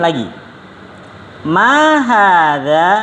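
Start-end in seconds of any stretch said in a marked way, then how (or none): 1.4-2.9 MA drawn out and arched high, HADZA rising at the end; slow, stretched question tune on "Ma hadza"; question intonation. Shown as a demonstration. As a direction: say "Ma hadza" very slowly as a lilting question. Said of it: "dibedakan intonasi pertanyaan".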